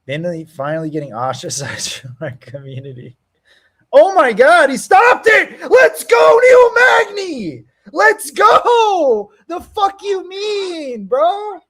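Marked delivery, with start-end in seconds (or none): 3.9-11.6 screaming high and loud in triumph; wild cheering shout. Real, not acted. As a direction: yell with joy as your pick wins. His fighter wins, cheering.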